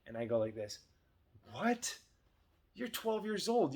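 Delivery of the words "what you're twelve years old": shocked, much higher pitch, slower; overreacting dad. Dad overreacting to daughter wanting coffee, shocked and high. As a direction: make a big deal of it, way up high. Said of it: "And I gave it energy".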